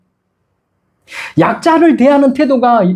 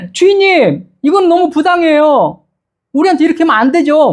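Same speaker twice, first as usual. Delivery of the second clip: servant's protest; 6 semitones higher than usual. Acted.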